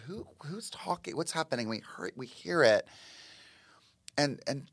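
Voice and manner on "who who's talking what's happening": softer, a nervous hushed question; hushed and uneasy. Retold or imitated. Chaz asks the room about the growling, hushed and uneasy.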